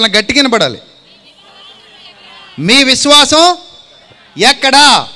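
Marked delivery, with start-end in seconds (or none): 2.6-5.1 loud challenging question. Acted. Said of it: "అప్పుడు ఆయన ఏమని అడిగారు".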